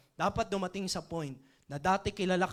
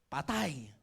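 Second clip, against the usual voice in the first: ordinary talk, nothing marked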